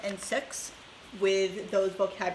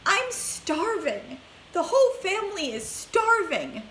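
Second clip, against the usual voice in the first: put-on whiny old woman; 9 semitones higher than usual. A narrator's reading. Grandma complains of hunger.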